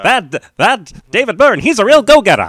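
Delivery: hammed-up vintage newsreel praise; old newsreel narrator. Continues old-timey praise bit.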